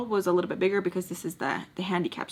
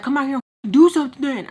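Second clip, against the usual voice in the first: louder, higher fast yell; 6 semitones higher, 9 dB louder, faster than usual. Retold or imitated. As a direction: shout a challenge. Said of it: "the girl starts yelling back".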